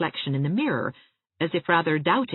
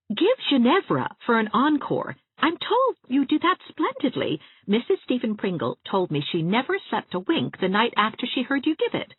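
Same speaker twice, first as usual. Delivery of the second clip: put-on bright higher coaxing girl voice; eager young friend, pitched up; Anne, coaxing; 8 semitones higher, 3 dB louder than usual. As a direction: lift the voice and coax her eagerly.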